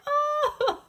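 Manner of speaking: high, sobbing princess wail; hammed-up sob, lost and helpless; crying. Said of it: "she began to cry".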